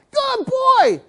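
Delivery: very high squeal of praise; really excited. Acted. Owner praising dog that finally sits, excited squeal. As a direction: gush as the dog finally obeys. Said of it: "now you're really excited".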